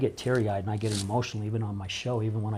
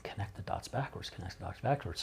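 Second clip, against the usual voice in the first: hushed, softer repeated musing; pondering to himself; 9 dB quieter than usual. Retold or imitated. Man mulling a guest's words on the way home, pondering softly. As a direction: turn it over quietly in your head. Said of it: "I was pondering that".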